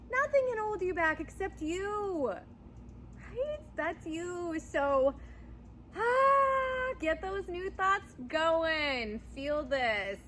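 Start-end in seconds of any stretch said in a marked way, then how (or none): 0.1-3.6 higher, punchier take; higher, more urgent retake; lifted emphatic. Real, not acted. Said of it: "you got this". 6.0-9.2 excited cry. Real, not acted. Fired-up encouragement.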